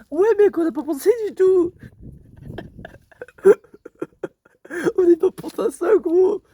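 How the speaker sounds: loud, excited realisation; excited exclaim. Real, not acted. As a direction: blurt it out, all worked up. Realising their clothes will get dirty.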